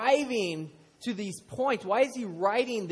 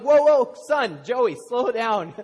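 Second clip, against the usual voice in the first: higher, louder, startled whoa; father catching his son's rapid chatter, startled and louder; urging him to slow down; 4 semitones higher, 8 dB louder, slower than usual. Retold or imitated. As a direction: rein in an excited kid. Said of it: "And I'm like".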